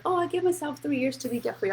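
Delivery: a hint of the assistant musing about her plans; imitating her assistant. Assistant telling her boss her debt plan, lightly imitated. Assistant voiced in retold chat.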